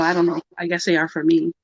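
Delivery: normal talking voice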